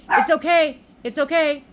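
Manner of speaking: high, soothing aside; real soothing reassurance. Real, not acted. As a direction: reassure in a high-pitched singsong. Soothing someone after shushing them.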